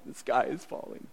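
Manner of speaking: whispered, fretful repeat; whispered mock panic. Preacher mimics Naomi's despair in a mock whisper. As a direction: breathe it out like a frightened whisper. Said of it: "Naomi going like".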